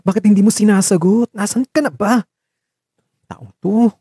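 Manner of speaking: worried. Acted. Worried calls unanswered.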